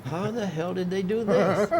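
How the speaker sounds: high-pitched acted disbelief; high, incredulous mock outburst; retold bafflement. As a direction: act out being utterly baffled. Retold amazement at the moved book.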